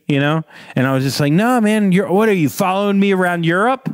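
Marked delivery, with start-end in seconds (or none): 1.3-3.9 high, incredulous mock confrontation; retold incredulous challenge. Actor confronting the fan following him, high and incredulous. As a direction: act out being baffled by a stalker. Star acted as alarmed at fan.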